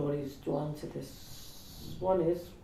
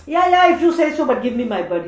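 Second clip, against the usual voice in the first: louder and higher, impatient; insistent cousin; 7 semitones higher, 11 dB louder, faster than usual. Retold or imitated. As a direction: brush him off, loudly. Cousin demands the bird.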